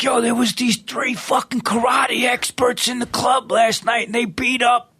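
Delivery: high excited shouting voice; excited retelling. Retold or imitated. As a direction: shout it like a club owner who can't believe it. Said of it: "He's like".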